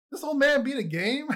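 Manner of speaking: high, loud mock disbelief; incredulous disbelief. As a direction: act out kids being stunned that the old man actually beat it. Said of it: "we're like".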